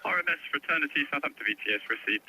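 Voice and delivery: ordinary talk, nothing marked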